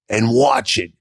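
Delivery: "watch" flung up high in defiance; defiant high call. Retold or imitated. Telling the people who doubted him, high and defiant. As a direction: throw it back at the doubters.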